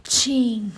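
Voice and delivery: sudden loud sneeze; a real sneeze, sharp and high; sneezing. Real, not acted. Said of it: "vou espirrar".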